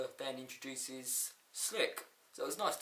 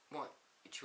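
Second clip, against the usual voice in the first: ordinary talk, nothing marked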